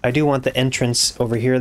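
ordinary delivery

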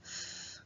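voiceless f on its own, held; isolated f sound. Shown as a demonstration. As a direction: push out a long f phoneme. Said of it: "first grapheme we need to write is".

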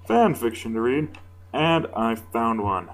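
ordinary delivery, nothing marked

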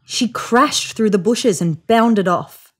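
usual speaking voice

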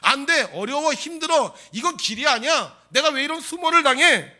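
high, loud exasperated outburst; indignant giving up. Acted. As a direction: protest hotly and refuse to go on. One without faith giving up. (높고 크게 분통을 터뜨리듯)

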